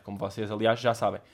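normal talking voice

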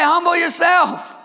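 loud, high call to echo; call for the church to repeat. Shown as a demonstration. Pastor asking the congregation to repeat, loud call. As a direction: give them the line to say back. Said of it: "Somebody say".

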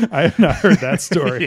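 cracking up while getting the words out; real, helpless amusement under the words; laughing. Real, not acted. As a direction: talk through real laughter. Bursts out laughing at slap story.

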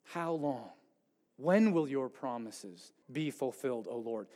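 hushed, aching question to God; a quiet sorrowful plea; quiet pleading lament. Retold or imitated. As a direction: let it drop low and soft, worn out. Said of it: "His question is".

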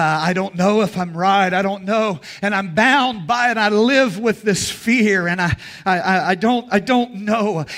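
fretting and unsure, stumbling over words; anxious believer. Retold or imitated. Preacher voices a fearful believer.

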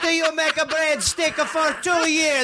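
hammed-up Italian club boss; mock Italian accent. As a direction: lay the cartoon Italian accent on thick. Improvises as the accented booker.

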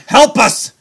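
high, loud play-acted plea; mock cry for help. Host pleading with listeners for money, mock cry for help. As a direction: cry out in pretend desperation.

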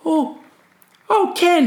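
very high, innocent, bewildered voice; confused surfer. Retold or imitated. Surfer who just burned Ken, feigning innocence in a high voice. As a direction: play dumb in a squeaky high voice. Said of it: "he was, like, really confused".

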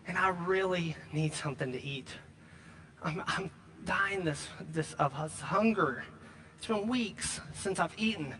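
weak hungry plea. Retold or imitated. Starving stranger begs at the door, faint and weak. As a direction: sound worn out from weeks of hunger. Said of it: "knocks on the door and says".

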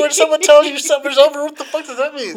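high, incredulous rant; baffled outburst. Retold or imitated. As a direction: demand an answer in worked-up disbelief. Animated bafflement at customer's phrase.

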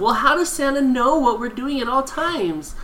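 high-pitched imitation of a curious kid; mock childlike wondering; questioning child. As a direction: put on a kid's high voice for a question. Retold child's question about Santa.

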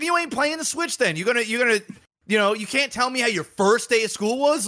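high, lightly stern dad; mock scolding. Dad taking the Switch from his son, mock scolding. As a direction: scold him half-laughing.

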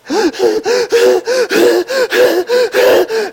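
ragged gasps for air, acted out; breathless gasping. Shown as a demonstration. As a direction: pant like a winded runner. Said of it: "it's hard to talk".

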